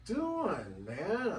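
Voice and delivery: high, startled "what are you doing, man"; startled neighbor. Retold or imitated. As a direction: play the baffled neighbor catching him. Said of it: "person came out and says".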